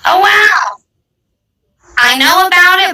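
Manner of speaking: ordinary talk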